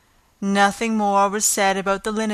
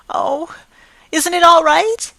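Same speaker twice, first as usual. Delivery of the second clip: anguished worried question; 9 semitones higher, 8 dB louder than usual. Acted. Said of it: "cried Anne with a look of anguish".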